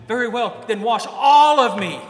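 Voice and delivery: loud, exasperated outburst with a laugh; snapping retort. Retold or imitated. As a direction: bark it back, half laughing. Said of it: "he snapped".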